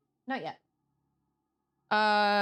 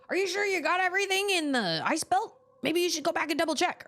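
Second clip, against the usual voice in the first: higher mock nagging voice; quick raised nagging; quoting Miles; 8 semitones higher, faster than usual. Retelling Miles' advice in a mock voice. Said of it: "he was like".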